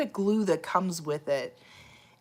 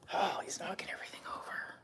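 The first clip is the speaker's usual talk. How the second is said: whisper; 14 dB quieter than usual. Real, not acted. Cat knocking things over.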